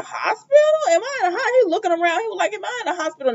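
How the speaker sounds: high, bewildered questioning; waking up lost and confused; dazed confusion. Retold or imitated. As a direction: Finn confused in hospital bed, high.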